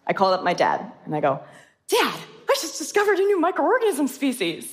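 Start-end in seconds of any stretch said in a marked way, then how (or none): 1.9-4.7 high-pitched, excited announcement to dad; imitating her own thrilled high voice; excited retelling. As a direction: re-enact breaking big news to a parent, voice way up. Retold excited phone call to dad.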